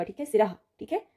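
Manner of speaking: ordinary delivery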